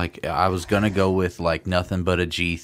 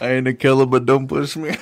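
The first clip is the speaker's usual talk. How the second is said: high, loud, hammed-up gangster line; mock tough guy; 6 semitones higher, 6 dB louder than usual. Friend joking she's a dangerous killer, loud mock threat. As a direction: ham up a menacing threat.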